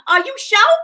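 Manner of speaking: high, loud mock of the actress, "sure" as "sho"; imitating forced slang. Vlogger mocks an actress's way of saying sure, exaggerated high slang voice. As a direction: mimic her slang in a loud squeal. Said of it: "trying to say words like slang".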